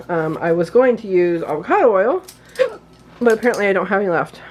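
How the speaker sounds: ordinary talk, nothing marked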